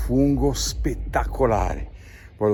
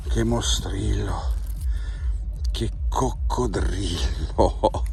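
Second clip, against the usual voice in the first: whispering; slower than usual. Real, not acted. Hushed awe over big mushrooms.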